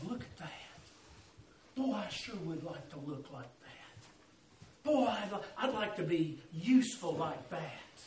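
soft, low wistful admiration; hushed longing. Acted. Clay on the shelf admiring finished vases, hushed longing. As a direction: murmur it low, full of wishing.

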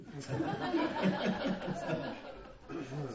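long open laugh at his own punchline; laughter at own joke. Real, not acted. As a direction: laugh at the joke you just told.

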